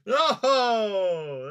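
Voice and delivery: high, loud hammed-up wail; hammed-up high, loud wail about being broke; mock despair. Mock despair losing souls.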